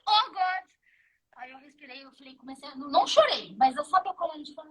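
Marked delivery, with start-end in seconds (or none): 0.1-0.7 louder, higher mock-desperate exclamation; retold exclamation. Traveler retelling her plea at immigration, mock desperate. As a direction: replay your cry for help, amused.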